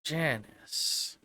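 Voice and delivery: quiet, pondering; musing murmur. Acted. Jack puzzles over a familiar name, murmuring. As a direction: softly say the name to yourself.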